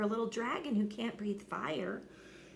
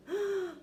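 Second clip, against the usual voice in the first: gasp; 10 semitones higher than usual. Acted. Mother shocked.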